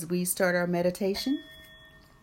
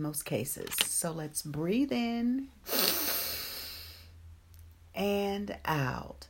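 guided meditation; 5 dB quieter than usual. Acted. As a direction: ease them gently into calm.

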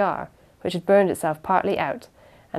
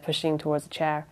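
ordinary delivery

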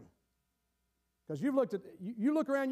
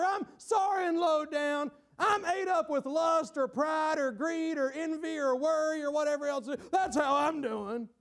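hammed-up self-condemnation; 4 semitones higher than usual. Victim-minded churchgoer answering the preacher, hammed-up self-condemnation. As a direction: cry out each failing at the top of your voice. Acts victim declaiming own failures.

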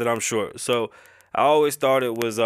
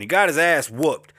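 normal talking voice

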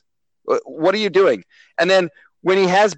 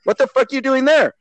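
higher, faster incredulous rant; exasperated rant; 7 semitones higher than usual. Real, not acted. Ranting to friends about the party invite, exasperated. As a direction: pile on the disbelief.

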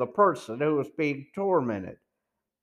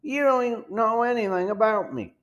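uneasy; 7 semitones higher than usual. A narrator's reading. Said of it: "a hint of uneasiness in his voice".

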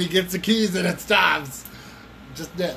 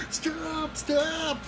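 high, quiet mock scream of "stop"; high-pitched pretend begging; imitated plea; 12 semitones higher, 5 dB quieter than usual. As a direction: squeal it like a horror-film girlfriend, held back. Acting out the film's girlfriend.

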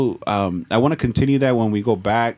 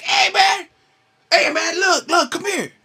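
put-on old man voice. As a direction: yell like a grumpy old man flagging someone down. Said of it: "He's like".

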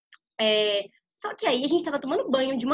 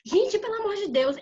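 much higher urgent calls to hurry; high-pitched rushed urging; hurrying teachers; 8 semitones higher than usual. Retold or imitated. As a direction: chase everyone out the door. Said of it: "os professores".

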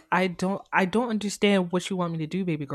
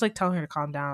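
ordinary delivery

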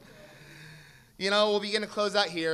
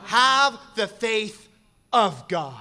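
"Have the faith" drawn out slowly and loud; slow emphatic have; 3 semitones higher, 8 dB louder, much slower than usual. Shown as a demonstration. Preacher drives home the verse, slow and loud. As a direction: lean hard on "Have", slowly. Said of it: "So what Jesus is saying".

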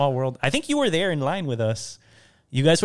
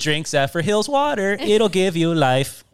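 higher, louder pitch, slow on give you life; mock ad read; 5 semitones higher, 3 dB louder than usual. Joking plug for Zephyr Hills water to the hosts, hammed-up ad voice. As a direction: sell it like a hammed-up commercial.

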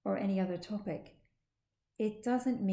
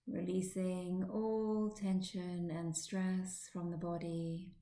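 slow meditation voice, long pauses; guided meditation; slower than usual. Acted. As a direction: ease the listener slowly into stillness. Leads guided relaxation.

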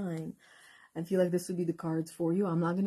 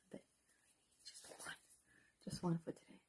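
whispering; 14 dB quieter than usual. Real, not acted. Whispered to herself.

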